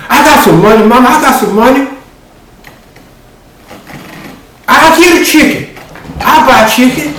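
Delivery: put-on high, eager little-boy voice; high pleading child's voice; begging child. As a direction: plead with mama like a kid. Child begging his mother.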